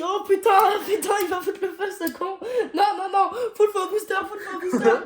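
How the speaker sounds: high, loud, laughing outburst; excited yelling. Real, not acted. Hyping the last booster, wildly excited. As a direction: yell it high with laughter breaking in.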